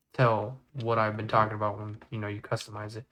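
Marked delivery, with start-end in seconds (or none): none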